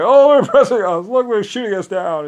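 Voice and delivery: high, loud mock whining; mocking whine. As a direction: parody a staged victim. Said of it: "he takes all these pictures like".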